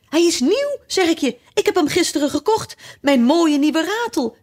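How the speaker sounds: very high-pitched indignant wail; furious, rising voice. A narrator's reading. Fiedeldij mourns his new rattle, furious and high. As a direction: rant at the top of your range. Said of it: "En zijn stem steeg".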